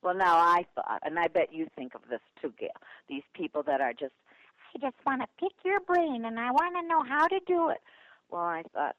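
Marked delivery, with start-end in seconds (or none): 4.7-7.8 high-pitched, put-on gushing fan voice; mock eager pesterer. People wanting to pick her brain, mock eager high voice. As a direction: mimic an over-eager favor-seeker, much higher.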